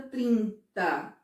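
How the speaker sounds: "trinta" slowly, as trin, then ta; measured "trinta" in two distinct beats; syllables stretched out. Shown as a demonstration. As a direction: draw out both syllables of "trinta". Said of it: "o som sai quantas vezes?".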